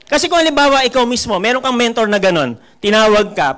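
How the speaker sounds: normal talking voice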